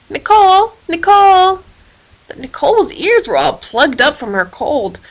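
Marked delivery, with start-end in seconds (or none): 0.1-1.6 high, louder calls; calling out. A narrator's reading. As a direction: call up to the bird on the wire. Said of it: "Hilary called out to her".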